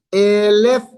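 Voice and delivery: "Elef" with a loud, stressed first E; stress on first syllable. Shown as a demonstration. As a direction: hit the opening E of "Elef" hard. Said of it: "el acento en la primera sílaba".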